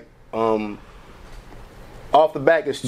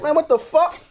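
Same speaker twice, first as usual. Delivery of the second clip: mock exasperation; 11 semitones higher than usual. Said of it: "So I'm like".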